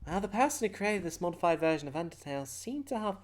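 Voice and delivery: high put-on character voice; Toucher explaining.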